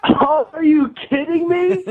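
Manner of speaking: high and slow, astonished; stunned disbelief. Real, not acted. Pranked caller learns the radio hosts set him up, stunned and high. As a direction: let the shock of being pranked spill out.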